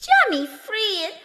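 high fairy cry of his name; elves crying out. Acted. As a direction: call him from up high. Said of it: "cried they".

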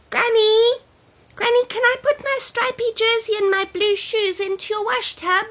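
put-on high bright child voice, eager; little girl voice. Child character speaking.

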